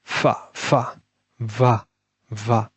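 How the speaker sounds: ফা then ভা, lip on teeth, softly; drilled f and v. Shown as a demonstration. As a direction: model ফা and ভা slowly for students. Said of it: "আর একটা হচ্ছে ভু". (শিক্ষকের মতো ফা ভা অনুশীলন)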